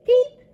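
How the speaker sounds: tiny, very high, loud peep; high storybook chick noise. A narrator's reading. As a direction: squeak out a baby chick's peep. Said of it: "her chick said".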